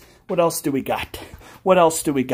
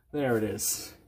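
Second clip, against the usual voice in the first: much softer, lower reveal; hushed reveal; 8 semitones lower, 12 dB quieter than usual. Pretend. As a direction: drop to a hush.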